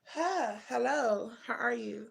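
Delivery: normal talking voice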